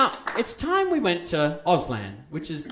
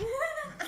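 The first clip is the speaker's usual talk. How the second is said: high laugh; 7 dB quieter than usual. Real, not acted. Laughs after the story.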